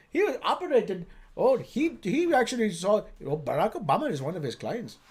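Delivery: put-on bragging parent with an Indian accent. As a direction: boast about a relative in a lilting Indian accent. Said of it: "That's a good Indian accent".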